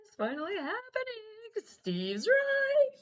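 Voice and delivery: high giddy squeal of joy. Retold or imitated. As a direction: squeal it like a smitten girl. Giddy inner monologue, squealed.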